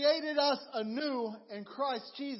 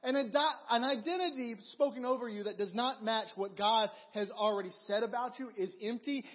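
ordinary talk, nothing marked

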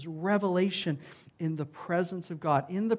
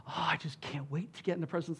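fast breathless whisper of mock eagerness; eager worshiper; 8 dB quieter, faster than usual. Preacher mocking his old eager-beaver reading, quick whisper. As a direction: gush it under your breath like an eager beaver. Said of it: "like the avid worshiper".

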